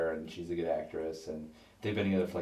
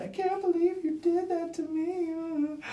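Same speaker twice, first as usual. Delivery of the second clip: very high, slow, wailing outrage; betrayed actress wailing at her co-star; mock outrage; 3 dB louder, slower than usual. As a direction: drag out each word in hurt disbelief. She's furious at Edward Norton.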